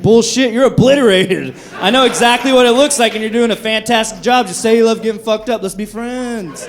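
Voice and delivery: put-on loud, laughing callout of drunks. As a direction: call them out, loud and amused. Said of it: "I'm like".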